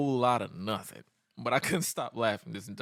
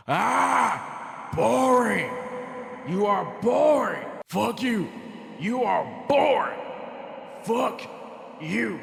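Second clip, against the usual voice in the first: mock yell; 9 semitones higher, 4 dB louder, much slower than usual. Imitating Stone Cold insulting Jericho.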